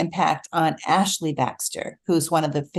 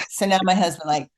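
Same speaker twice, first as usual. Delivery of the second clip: normal talking voice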